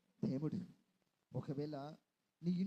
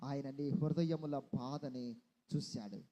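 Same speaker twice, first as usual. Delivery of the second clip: tender comfort; 7 semitones lower than usual. Acted. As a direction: comfort a weeping mother gently. Comforting a grieving listener.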